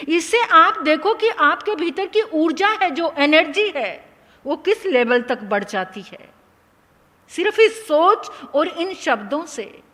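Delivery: ordinary talk, nothing marked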